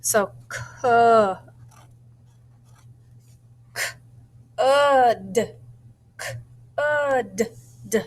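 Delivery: sounding out "could" to write it down. Shown as a demonstration. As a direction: pull apart the sounds of "could" again. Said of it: "that uh said O-O".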